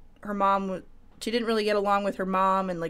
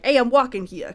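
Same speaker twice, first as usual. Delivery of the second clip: put-on New York accent; 4 semitones higher, 7 dB louder than usual. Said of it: "kinda, like, kind of voices".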